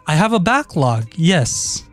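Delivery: usual speaking voice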